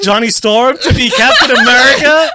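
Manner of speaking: high, loud voice of disbelief; incredulous. Retold or imitated. Retelling own shock at the casting news, incredulous.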